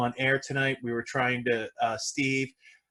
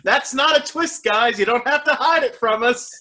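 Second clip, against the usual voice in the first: mock exasperated; 9 semitones higher, 4 dB louder than usual. Mock scolding the filmmakers.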